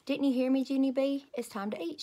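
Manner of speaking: a hint of a patient mother; mother calling. A narrator's reading. Mother voiced in Junie B story.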